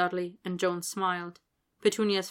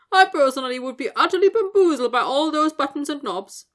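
higher, louder, eager little wizard gushing; bright flattery; 8 semitones higher, 9 dB louder than usual. Acted. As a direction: gush with delighted praise. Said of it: "Daedalus told Vernon brightly".

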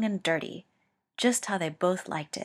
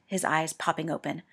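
ordinary delivery

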